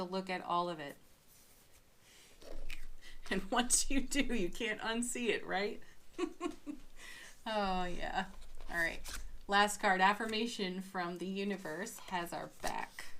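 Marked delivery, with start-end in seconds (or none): 5.9-7.4 a light, high breathy laugh; quiet amused giggle. Real, not acted. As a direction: let a soft airy giggle slip out. Laughing at her own remark.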